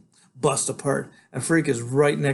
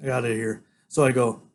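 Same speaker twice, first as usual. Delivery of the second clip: plain reading voice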